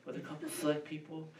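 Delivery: hushed, mumbled mock excuse, trailing into a laugh. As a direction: dodge the question with a sheepish murmur. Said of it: "I don't say".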